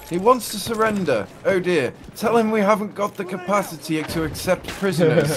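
high, loud, theatrical film quote; acted film officer. Gamer quoting a British war film to a friend, hammed-up and high-pitched. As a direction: deliver it as hammed-up comic movie dialogue.

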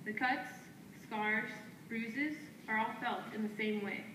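firm, declaiming poetry lines traded back and forth; spoken word duet. Acted. Two poets trading lines on stage, in a firm declaiming delivery. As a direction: answer your partner line by line like a chant. Partner continues the poem.

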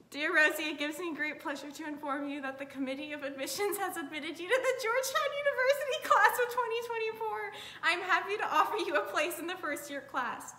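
thrilled letter reading; excited reading. Real, not acted. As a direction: read aloud, barely holding in joy. Reads acceptance letter aloud excitedly.

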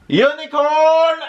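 high, drawn-out battle cry; commander's scream. Acted. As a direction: scream the charge order, long and loud. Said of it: "Das Commander scream".

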